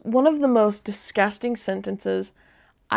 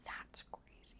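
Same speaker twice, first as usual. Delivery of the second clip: whisper; 32 dB quieter than usual. Real, not acted. Aside after creepy message.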